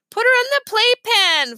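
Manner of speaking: high, loud wail; wailing little boy. A narrator's reading. Fudge wailing at Dad after Tootsie wrecks his Legos. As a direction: wail it like a cross little kid. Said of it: "Fudge wailed".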